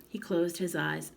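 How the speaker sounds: usual speaking voice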